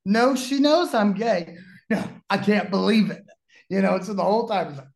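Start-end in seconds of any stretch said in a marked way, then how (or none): 0.1-1.6 amused correction. Retold or imitated. Narrator answering Bobby, retold. 1.9-3.2 mock disbelief. Bobby still in disbelief.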